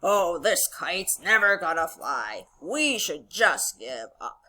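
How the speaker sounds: low, slow, discouraged toad; Toad character voice. Acted. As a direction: drag it out in defeat. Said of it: "Toad returns back to Frog and he says".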